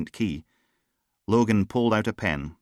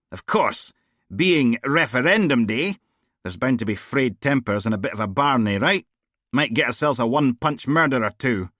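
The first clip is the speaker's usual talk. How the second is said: a hint of a blunt, raised-voice female DI; tossing off casual jibes, a little louder; lightly voiced brash boss; 5 semitones higher, 5 dB louder than usual. Bored boss grumbling to her officer, lightly voiced. Steele musing on referendum day.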